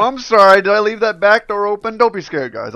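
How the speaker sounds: high, loud, over-apologetic outburst; loud mock apology. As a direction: blurt out a hammed-up apology. Acting out an apologetic Canadian intruder.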